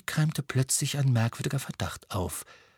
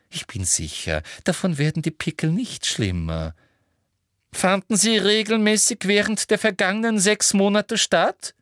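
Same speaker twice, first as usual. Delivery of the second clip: high, coaxing, pushy voice; insistent Umbridge; 10 semitones higher than usual. Acted. Umbridge prompts Marietta about the meetings, insistent coaxing. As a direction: press the question, pitched high, then drop.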